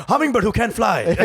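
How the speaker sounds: ordinary delivery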